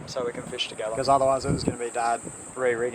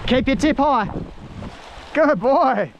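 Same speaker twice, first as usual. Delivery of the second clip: excited coaching; 12 semitones higher, slower than usual. Real, not acted. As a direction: cheer him on while he fights it. Coaching kid fighting a fish.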